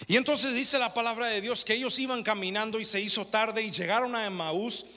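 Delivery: loud, high, fervent sermon delivery; full-force preaching. Acted. Preacher retelling Emmaus to the congregation, full-force preaching. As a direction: preach it to the congregation with mounting fire.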